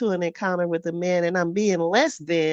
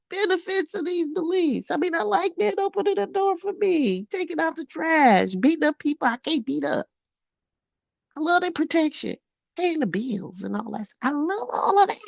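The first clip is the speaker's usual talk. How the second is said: gushing high; 10 semitones higher than usual. Real, not acted. Gushing about men's benefits.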